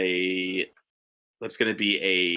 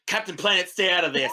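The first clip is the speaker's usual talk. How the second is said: Barb snapping back; 7 semitones higher than usual. Acted. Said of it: "I look back, I'm like".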